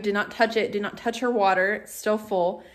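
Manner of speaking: ordinary talk, nothing marked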